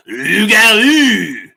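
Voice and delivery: hammed-up ring announcer call; loud, high, drawn-out announcer flourish; Jimmy Lennon Junior. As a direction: roll it out like a fight introduction. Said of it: "is gonna say Rougarou".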